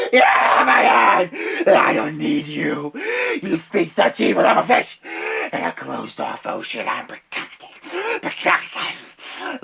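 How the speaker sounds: loud, crazed shouting; hysterical rant. Acted. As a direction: rave at full volume, unhinged. Dave's hysterical rant continues.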